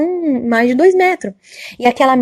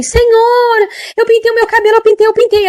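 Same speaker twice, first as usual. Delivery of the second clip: put-on high, loud, pleading old lady voice; a frightened old woman confessing; frantic old woman; 11 semitones higher, 4 dB louder than usual. Old woman confessing to the angel, high and pleading.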